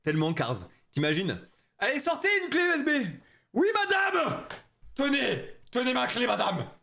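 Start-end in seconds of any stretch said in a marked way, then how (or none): 1.8-6.7 high voices for teacher and pupil; acted classroom. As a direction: play the scene at school. Acts teacher and pupil scene. (saynète prof et élève, voix aiguës)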